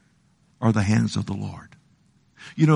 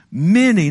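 "Many" punched high and loud; stress on many; 8 semitones higher, 5 dB louder than usual. Acted. Preacher pounding "Many" to the congregation. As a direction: hit "Many" hard and high.

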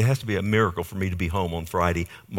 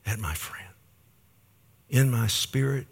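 ordinary talk, nothing marked

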